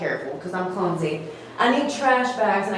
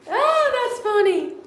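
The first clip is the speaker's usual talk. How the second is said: surprised; 12 semitones higher, slower than usual. Real, not acted. Amused reaction, high pitch.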